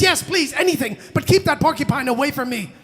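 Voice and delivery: high, urgent, acted pleading; panicked agreement. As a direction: rush to agree as a scared tiger. Frightened tiger agrees, begging.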